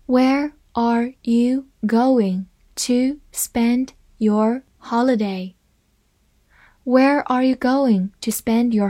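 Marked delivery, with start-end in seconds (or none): 0.1-5.6 slow and clear, every word spaced out; slow reading. Shown as a demonstration. Teacher reads the question slowly to students, slow and clear. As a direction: read it slowly so students can follow. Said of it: "好，慢慢来读".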